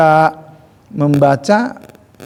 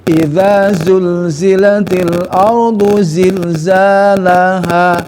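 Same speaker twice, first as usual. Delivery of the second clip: slow, flowing Quranic chant; connected recitation; 4 semitones higher, 4 dB louder, much slower than usual. Shown as a demonstration. Teacher reciting verse joined in flowing chant. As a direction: link the words in one slow chant. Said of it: "sehingga tepat".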